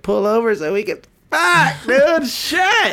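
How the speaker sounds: hammed-up horny outburst; mock lustful shout. Joking reply to the sexy-voice compliment, high mock shout. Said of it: "I was like".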